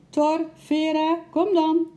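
high, drawn-out loud call; loud sing-out summons, stretched slowly. A narrator's reading. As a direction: call the animals over from afar. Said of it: "riep hij".

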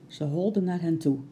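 plain reading voice

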